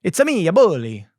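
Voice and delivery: Mario impression. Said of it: "Mario-style joke".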